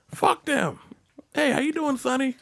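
high-pitched old-timer imitation; comic high-voiced cheek; brazen old driver. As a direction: play an unbothered old man greeting customers. Old driver greeting customers, retold.

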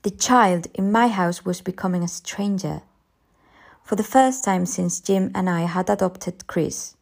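ordinary delivery

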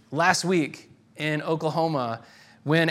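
ordinary talk, nothing marked

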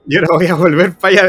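retold loud refusal. Wife refusing to go back, loud retold refusal. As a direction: push it out, refusing flatly.

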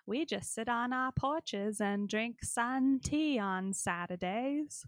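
folksy small-town lady. Retold or imitated. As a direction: lightly play a sleepy small-town lady. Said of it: "how she's like".

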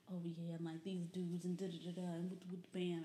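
lightly voiced slangy trap boy rambling; a hint of Chicago street talk. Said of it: "Chicago trap boy".

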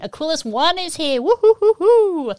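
high announcement into loud whooping; excited welcome. Real, not acted. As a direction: cheer the new arrival in. Cheers a chatroom arrival.

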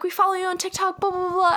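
high-pitched fangirl chatter; imitated teenage fans. Girls greeting her as TikTok followers, imitated fangirls. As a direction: rattle it off in a high fan voice.

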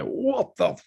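high, slow, stretched-out shocked cry; drawn-out, high disbelieving yell; shocked friends. Retold or imitated. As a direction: draw it out like a stunned friend. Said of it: "all his friends were just like".

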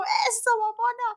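high, loud excited cheer; cheering friend. Retold or imitated. Pepe cheering as his friend reaches him, high and loud. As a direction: celebrate her arrival. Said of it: "y luego me dijo".

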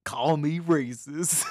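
loud, slow, drawn-out declaration; mock confession. Real, not acted. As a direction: declare it slowly and loudly for comic effect. Mock confession amid accent argument.

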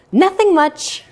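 cheerful casual answer. Shown as a demonstration. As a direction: sound happy and free to chat. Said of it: "use a cheerful voice as you say".

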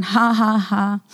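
low, joyless mock laugh; forced laugh. Speaker retelling laughing while feeling mocked by devil, forced. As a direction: separate fake ha-has, trailing down. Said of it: "I felt so stupid".

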